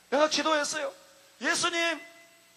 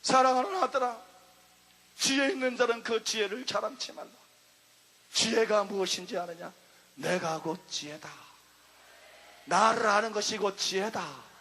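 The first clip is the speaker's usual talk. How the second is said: voice of the Spirit; 7 semitones lower than usual. Retold or imitated. The Spirit gently answers the beloved son, low and tender. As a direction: speak low and gently, like a father. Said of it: "성령께서 말씀했어요".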